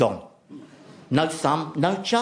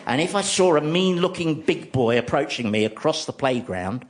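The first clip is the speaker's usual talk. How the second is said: ordinary talk, nothing marked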